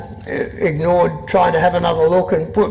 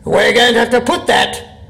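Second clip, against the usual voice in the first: poem reading; 6 semitones higher than usual. Acted. Reads his poem aloud.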